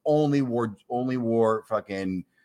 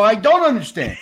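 higher and louder, furious sarcasm; outraged yell; 13 semitones higher, 9 dB louder than usual. Retold or imitated. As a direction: bark it back at him, fed up. Outraged retort to the bookie.

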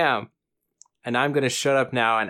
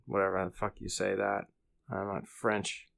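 quiet mumbled aside; muttering; 3 semitones lower, 9 dB quieter than usual. Real, not acted. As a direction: mutter it under your breath. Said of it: "mumbling aside, very quiet".

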